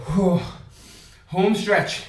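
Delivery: normal talking voice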